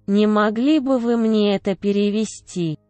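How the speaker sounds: ordinary talk, nothing marked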